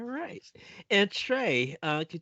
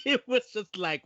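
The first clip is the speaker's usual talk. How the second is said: laughing; 8 semitones higher, 3 dB louder than usual. Real, not acted. Laughing recalling the clip.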